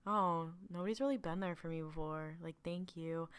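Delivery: quietly grateful, as if nobody ever cared; a hushed thank you from a lonely man; soft touched thanks. Retold or imitated. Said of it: "Thrawn being like".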